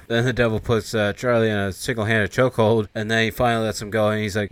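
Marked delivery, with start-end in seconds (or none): none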